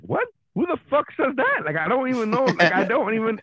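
high-pitched indignant disbelief; shocked. Retold or imitated. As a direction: retell it as utterly baffled and thrown. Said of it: "so shocked at the setup".

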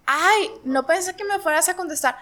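high, whiny surprised excuse; mock apologetic. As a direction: play the caught troll. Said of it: "Les contestas".

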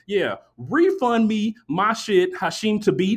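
loud, high, slow indignant demand; hammed-up outraged customer; angry demand. Acted angry demand to agent.

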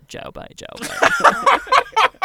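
high, very slow, laughing catcall; sing-song jeer at the field, giggling; mock catcall. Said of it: "we go".

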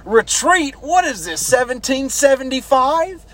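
high-pitched, mocking disbelief; incredulous drill sergeant. Drill sergeant answering a recruit's yell, high incredulous imitation. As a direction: throw the word back in scornful disbelief. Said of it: "Drill Sergeant Olson goes".

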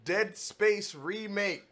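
slow, high, loud headline; excited announcement. Real, not acted.

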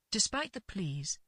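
usual speaking voice

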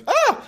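sudden high startled gasp; surprised gasp. Retold or imitated. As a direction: let out a startled squeal. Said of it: "Jane goes".

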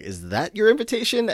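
high-pitched mock disbelief; imitated incredulous girlfriend. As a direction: quote her, pitch leaping up in disbelief. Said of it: "And she's like".

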